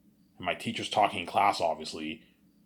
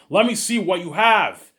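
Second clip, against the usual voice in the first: slow, loud, higher, every word pressed; insistent repeat; 8 semitones higher, 7 dB louder, slower than usual. Retold or imitated. Teacher repeats the demand to the stalling kid, slow and loud. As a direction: lose patience and spell it out slowly.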